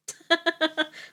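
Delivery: a short high laugh at herself; bright amused laugh. Real, not acted. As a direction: burst out laughing at the memory. Laughing at the story.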